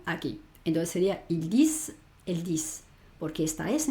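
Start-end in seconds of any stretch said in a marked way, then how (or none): 1.3-2.8 "il dis, el dis" each ending in an audible S; sounded S, silent ENT. Shown as a demonstration. Teacher shows where to stop, "dis" with its S pronounced. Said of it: "entonces sí que la pronuncio".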